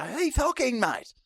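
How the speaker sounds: high excited shout of recognition; mock fan shout. Fans mobbing him, shouting his name. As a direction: call out like an eager fan spotting a star. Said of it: "they come up and go".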